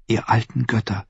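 put-on softly praying girl; hushed plea to the gods; whispered prayer. A narrator's reading. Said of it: "betete sie".

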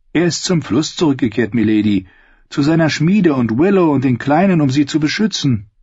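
lightly voiced girl; girl answering.

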